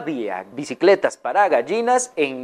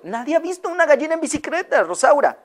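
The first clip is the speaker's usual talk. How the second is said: amused disbelief; 10 semitones higher than usual. Acted. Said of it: "se le quedó viendo".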